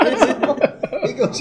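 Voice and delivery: loud laugh spilling into the words; can't hold back the laugh. Real, not acted. Laughing while retelling the call.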